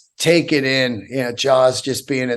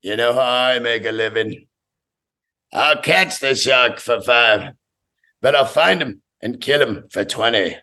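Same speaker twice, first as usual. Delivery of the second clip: a hint of an old shark hunter naming his price; Quint; 4 semitones lower than usual. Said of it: "Quint goes down there".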